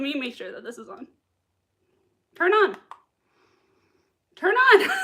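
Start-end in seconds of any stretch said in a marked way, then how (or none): 2.4-2.8 raised, higher-pitched order to a gadget; insistent command. Pretend. Commanding a clock that won't start. 4.4-4.9 loud, high-pitched order to a gadget; exasperated command. Pretend. Repeated command to the stubborn clock.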